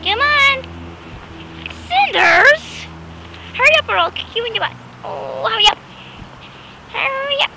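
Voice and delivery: high, insistent call to hurry; impatient high-pitched dog call. Real, not acted. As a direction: pester the dog along, pitched right up. Calling the dog along.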